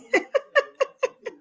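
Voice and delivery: high-pitched giggle; delighted real laughter. Real, not acted. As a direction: laugh in a rapid high run. Delighted at the banana pudding match.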